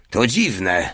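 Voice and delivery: usual speaking voice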